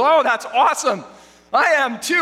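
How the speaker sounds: over-the-top delight; high, eager gush; gleeful. Acted. Man thrilled at shared denomination.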